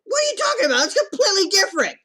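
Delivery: very high, loud shriek; hammed-up falsetto shriek; shrieking protest. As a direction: screech the objection.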